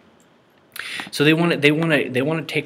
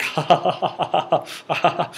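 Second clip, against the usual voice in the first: mock fake laugh; 6 semitones higher than usual. Mocking the man's laugh in the clip.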